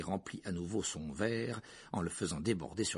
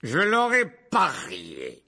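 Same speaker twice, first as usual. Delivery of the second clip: loud, slow, higher scornful cry; triumphant exclamation; 7 semitones higher, 12 dB louder, much slower than usual. A narrator's reading. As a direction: exclaim it as if proved right. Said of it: "s'exclama la tante Marge". (exclamation forte et triomphante)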